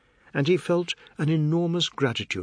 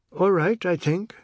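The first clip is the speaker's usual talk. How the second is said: higher, jokey little voice; humorous high-pitched voice; 7 semitones higher, 4 dB louder than usual. Acted. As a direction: answer lightly, playing the fool. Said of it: "his humorous, high-pitched voice".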